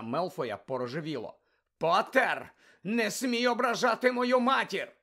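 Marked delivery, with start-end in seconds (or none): 1.8-4.9 furious. Acted. Malfoy furious at Harry.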